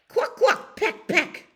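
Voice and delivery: high, squawky hen noises; very high, pecking cackle; chicken clucking. Acted.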